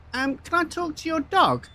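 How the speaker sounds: a hint of a high-voiced joke character. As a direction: pitch it up as the asking visitor. Voices visitor in told joke.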